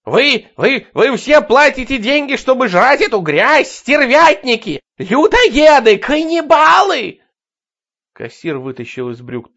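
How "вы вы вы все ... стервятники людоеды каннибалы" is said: hysterical shrieking. Acted. Madwoman raving at crowd.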